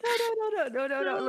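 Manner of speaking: high protest. Real, not acted. Pleading, laughing.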